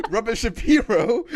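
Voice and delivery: laughing through the name. Real, not acted. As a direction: chuckle the correction out. Laughing correction.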